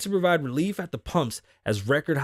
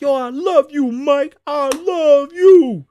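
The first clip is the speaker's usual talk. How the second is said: much higher, much louder, stretched out; mock yelled love; 13 semitones higher, 11 dB louder, much slower than usual. Fan yells love at Mike Tyson, high stretched mock yell.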